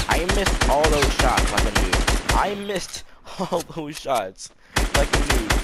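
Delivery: loud, drawn-out exasperation; exasperated. Real, not acted. Gamer lamenting his own missed shots, exasperated. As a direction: stretch the words out in loud frustration.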